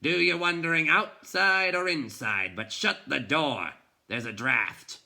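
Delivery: loud, high, cross bark. A narrator's reading. Bookseller calls out crossly to the boy at the door. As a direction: snap at the intruder from behind the books. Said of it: "heard a cross voice".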